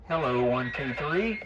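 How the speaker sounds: ordinary talk, nothing marked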